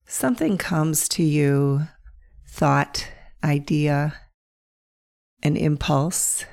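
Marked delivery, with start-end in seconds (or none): none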